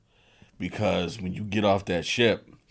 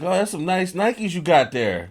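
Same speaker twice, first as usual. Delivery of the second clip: higher, louder mock Jamaican compliment; a hint of Jamaican accent, a sly compliment; 10 semitones higher, 5 dB louder than usual. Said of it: "But in their accent".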